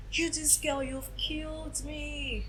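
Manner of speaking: high, soft, drawn-out reproach, imitated; shocked mother. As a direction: imitate a mother stricken by what she has heard. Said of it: "the shock was too much for her".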